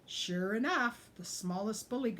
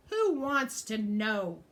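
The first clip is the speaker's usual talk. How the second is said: snarling; 2 semitones higher, 7 dB louder, slower than usual. A narrator's reading. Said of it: "snarled Rough".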